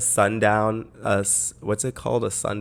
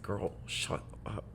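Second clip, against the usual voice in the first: hushed; 14 dB quieter than usual. Retold or imitated. Dismissing friend's gross remark.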